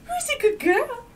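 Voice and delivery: very high squeaky praise; squeaky praising a dog. Real, not acted.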